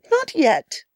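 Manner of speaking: high, slow coy refusal; lightly played teasing girl. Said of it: "said the girl".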